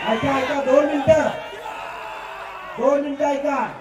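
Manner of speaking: high shout, दोन stressed. Real, not acted. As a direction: cut through the noise. Shouting over the crowd. (गर्दीवर ओरडून)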